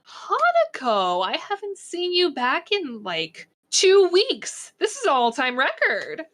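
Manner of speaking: excited greeting. Acted. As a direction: greet a long-missed friend, pitch leaping up. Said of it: "Their ears perk up".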